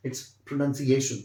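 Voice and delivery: pronunciation spoken slowly and clearly; teacher modelling the full word; slow careful model. Shown as a demonstration. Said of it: "अच्छे से pronounce करें".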